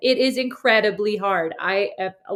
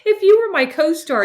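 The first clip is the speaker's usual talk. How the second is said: laughing; 10 semitones higher, 6 dB louder, slower than usual. Real, not acted. Laughing while protesting.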